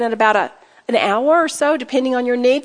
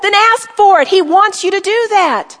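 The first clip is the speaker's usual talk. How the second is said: exhorting; 6 semitones higher, 4 dB louder than usual. Real, not acted. Preacher exhorting the congregation.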